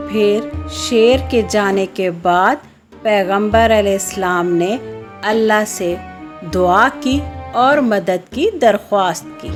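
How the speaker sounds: plain reading voice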